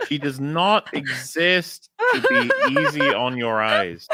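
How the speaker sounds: slow, loud, laughing lecture; sternly preaching, cracking up; mock preachy.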